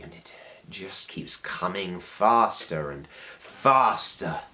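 building intensity. Acted. Monologue builds toward a shout.

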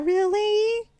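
high, mock-crushed contestant voice; hammed-up naive disappointment; rejected hopeful contestant. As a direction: squeak it in wounded disbelief. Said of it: "And I'd be saying there".

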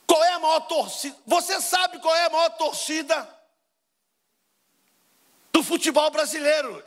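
bait the crowd with it, drawn out; teasing question. Acted. Preacher provoking the congregation about football, high teasing call.

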